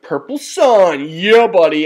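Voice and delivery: imitating the opponent's cocky cheer; gloating. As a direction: drag out Purple Sun with smug relish. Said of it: "and he's like".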